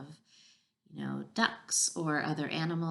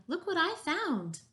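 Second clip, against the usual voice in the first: storybook shout, pitched way up; excited shout; 12 semitones higher than usual. A narrator's reading. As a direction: call out a proud discovery.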